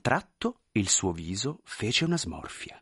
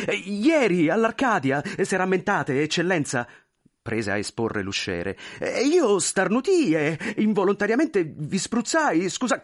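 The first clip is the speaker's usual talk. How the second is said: much higher, pleading, flustered voice; anxious clerk; 8 semitones higher, 3 dB louder than usual. Acted. Clerk pleading with the general, high and flustered. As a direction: beg forgiveness nervously.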